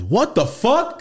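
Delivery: mock shocked. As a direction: blurt it out in disbelief. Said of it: "He goes".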